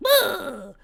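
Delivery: high, loud mock goblin shriek; a goblin lunging with a dagger, hammed-up scream; goblin battle cry. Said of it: "pulled out a dagger and went".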